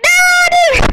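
high, loud cry of no; anguished shout. Real, not acted. Gamer just losing out in the game, anguished shout. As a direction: wail it at full volume.

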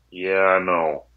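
low-pitched, level mumble of guilt; sheepish admission. Retold or imitated. Player confronted about selling the guitar, sheepishly admitting it. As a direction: imitate him owning up reluctantly.

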